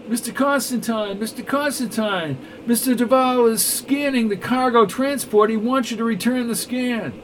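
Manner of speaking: high, hurried breathless report; urgent aide. Acted. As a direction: burst in with urgent news.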